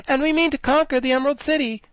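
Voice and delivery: high, loud and fast declaration; impatient. Acted. Ginger threatens the guardian, high fast impatient cry. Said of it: "stamping her foot impatiently".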